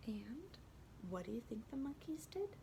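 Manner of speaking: very soft, teasing question; hushed suspense. A narrator's reading. Asks listeners, building suspense.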